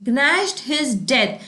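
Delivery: very slow and drawn out; slow idiom read. Shown as a demonstration. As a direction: spell the idiom out for learners. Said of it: "teacher announces next idiom". (बहुत धीमे, खींचकर)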